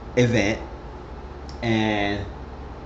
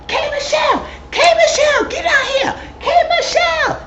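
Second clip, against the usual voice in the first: very high mock screech, laughing at the end; hammed-up shrieking caricature; mock screaming mom. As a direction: screech the name like a frantic fan mom. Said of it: "she started screaming".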